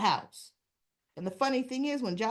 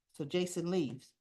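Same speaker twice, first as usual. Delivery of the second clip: usual speaking voice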